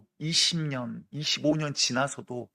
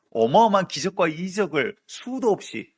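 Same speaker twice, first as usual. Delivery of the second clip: normal talking voice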